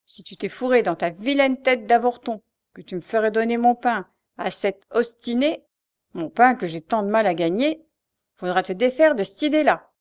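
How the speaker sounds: hands on hips, shout him down; angry shouting. A narrator's reading. Mother shouts at her son over her bread, loud rant. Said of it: "se mit à crier".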